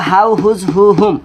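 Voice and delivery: HOW, WHOSE, WHO, WHOM each begun with a clear h; pronounced with H. Shown as a demonstration. Teacher recaps the four words to remember, pronounced with h. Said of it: "এই চারটা হ দিয়ে হবে".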